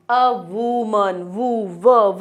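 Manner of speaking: woman stretched very slowly, then वु, व; slow teacherly breakdown of woman to व; sounding out onset. Shown as a demonstration. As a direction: draw out "woo" in woman, isolate व as "wuh". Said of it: "व की sound आ रही है".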